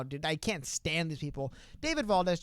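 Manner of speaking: normal talking voice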